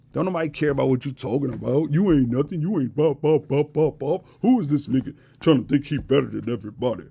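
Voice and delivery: imitating indignant naysayers; mock indignation. Mocks haters' indignant talk.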